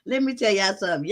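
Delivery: ordinary talk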